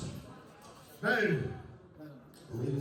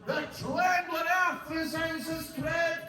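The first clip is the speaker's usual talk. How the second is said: raised, higher dramatic line; declaimed shout; 8 semitones higher, slower than usual. Acted. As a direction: let the tread boom.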